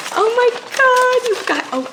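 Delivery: squealed astonishment; overwhelmed amazement. Real, not acted. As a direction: cry out in delighted disbelief. First sight of the book.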